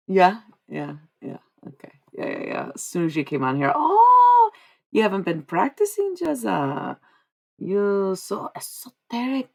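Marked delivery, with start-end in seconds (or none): 3.8-9.5 lightly voiced mocking spirits, high opening cry; teasing spirits. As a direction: tease them with a drawn-out, put-on voice. Channels spirits teasing the client.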